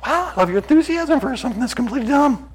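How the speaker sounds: high-pitched fake enthusiasm; mock admiration. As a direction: gush in a high, sarcastic voice.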